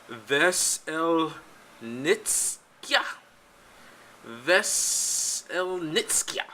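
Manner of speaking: "Veselnitskaya" sounded out slowly, piece by piece; careful pronunciation attempt. Real, not acted. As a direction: attempt "Veselnitskaya" syllable by syllable, drawn out. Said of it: "gonna try and pronounce this last name".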